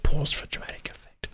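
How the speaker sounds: hushed, whispered stage direction; whispered aside. Acted. Host reading the synopsis to co-host, whispered aside. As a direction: drop to a mock-dramatic whisper.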